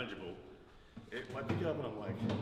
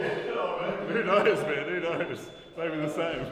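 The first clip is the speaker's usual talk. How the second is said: laughing; 4 semitones higher, 11 dB louder, slower than usual. Real, not acted. Laughing along at the joke.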